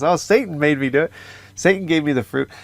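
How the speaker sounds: mock excuse-making. Eve blames Satan to God, mock defensive. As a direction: lightly act out shifting the blame.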